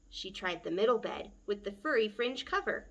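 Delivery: usual speaking voice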